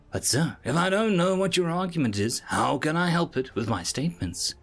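confused. A narrator's reading. Assistant puzzled by the observer's secrecy, confused. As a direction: ask with a touch of confusion. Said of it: "he asked in confusion".

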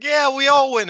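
high, loud imitation of a cheering winner; mock victory cheer. Fan imitates wrestler celebrating with her rivals, mock cheering. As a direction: shout it like a hammed-up champion.

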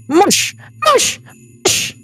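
high squeaky command; short, high-pitched goblin calls; goblin driving bears. Acted. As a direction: urge the sled animals on in a tiny goblin voice.

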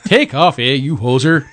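imitating a Canadian hoser; Canadian hoser accent. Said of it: "they're so good".

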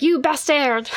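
a hammed-up fake French accent; lightly put-on French accent; bad French accent. Retelling the drama scene in a bad French accent. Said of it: "Did you like my bad French accent?".

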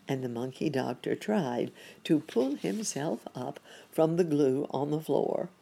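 ordinary delivery, nothing marked

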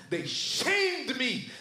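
slow, emphatic cry on "shamed"; stressed SHAMED. Acted. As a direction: stretch and lift the word "shamed". Climax of Paul's sufferings.

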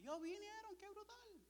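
very high mock squeal; amazed delight. Son sees his parents came, amazed high squeal.

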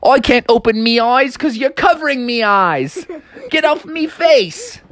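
high, squawking father with dialect "me"; exasperated dad. Acted. Dad tells Froggy off his face, exasperated. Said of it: "Froggy's dad was like".

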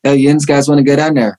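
light Pittsburgh yinzer accent on request. Shown as a demonstration. Guest asked to do her hometown Pittsburgh accent. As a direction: show off a hint of the Pittsburgh accent. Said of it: "can you still do the Pittsburgh accent?".

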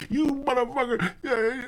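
high-pitched mock tirade; Joe Pesci. Customer cursing out his bank, high-pitched mock rant. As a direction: rant in a squeaky hammed-up voice. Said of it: "Joe Pesci on him".

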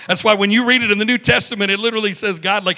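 normal talking voice

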